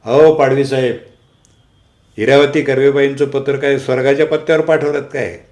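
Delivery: loud mocking call across the room; a colleague jeering loudly from across the meeting; shouting from afar. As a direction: shout it from the far end of the room, teasing. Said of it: "लांबूनच ओरडले".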